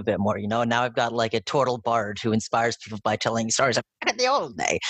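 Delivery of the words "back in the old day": swooping, hammed-up old-timer storyteller; put-on old-days storytelling voice. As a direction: play a bard reminiscing, with a big swoop on the last word. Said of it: "Your voices are also very fun".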